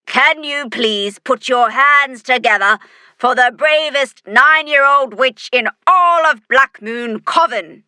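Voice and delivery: loud, high call to the crowd; shouting. A narrator's reading. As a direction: shout over wild cheering. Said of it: "she shouts".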